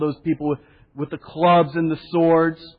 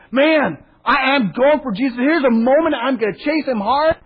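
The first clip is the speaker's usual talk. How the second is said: higher, louder, fired-up; fired-up Peter; 6 semitones higher, 4 dB louder than usual. Retold or imitated. Peter drawing his sword for Jesus, higher and louder with zeal. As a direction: charge ahead with zeal. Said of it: "Peter is just going".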